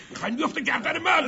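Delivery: loud and high, outraged; angry accusation. Acted. Gunman accusing his boss, outraged shout. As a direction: hurl the accusation.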